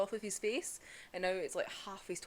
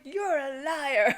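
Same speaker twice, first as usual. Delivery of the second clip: loud, slow, drawn-out mock yell; Tanjiro yelling liar; 5 semitones higher, 8 dB louder, much slower than usual. Tanjiro calling Muzan a liar, loud slow mock yell. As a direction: stretch out the accusation at full volume.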